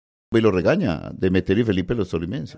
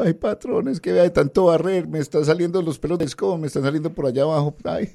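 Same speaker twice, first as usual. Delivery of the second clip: put-on high-pitched tearful servant girl, softer; crying country girl; 8 semitones higher, 3 dB quieter than usual. Host telling a joke, voicing the servant girl upset to her boss, high and tearful. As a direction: whimper it to the boss like a naive scared girl.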